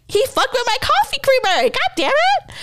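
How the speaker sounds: very high-pitched, comic indignation; hammed-up grievance, pitch shooting up; mock outrage. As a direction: squeal the complaint in playful exasperation. Mock outrage over coffee creamer.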